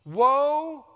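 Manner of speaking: loud, ringing "Woe" from the pulpit; emphatic woe. Shown as a demonstration. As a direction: proclaim "Woe" like a prophet's warning. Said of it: "that's what he says here".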